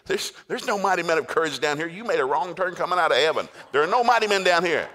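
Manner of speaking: high, loud exasperated protest; comic yelling. Retold or imitated. Hiding Gideon sends the angel away, comic yelling. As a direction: build to an indignant shout. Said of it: "Gideon starts yelling".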